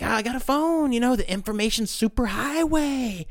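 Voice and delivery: high, loud-ish mock manic enthusiasm; imitated gushing rant. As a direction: imitate a wired man raving in wonder. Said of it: "going on this big rant".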